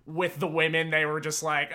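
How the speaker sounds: ordinary talk, nothing marked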